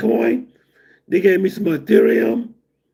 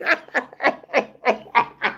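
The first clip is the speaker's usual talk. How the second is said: quieter, trailing chuckle; soft laugh; 5 semitones higher, 4 dB quieter than usual. Real, not acted. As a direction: let a soft chuckle fade out. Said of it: "laughs again".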